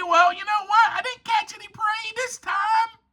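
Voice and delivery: high little cub voice; accepting lion cub. Acted. Lion cub after a failed hunt, high accepting voice. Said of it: "They're saying".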